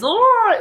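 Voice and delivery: sudden strained high yelp. Shown as a demonstration. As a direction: squeeze out a tight high oh. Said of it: "our tongue is".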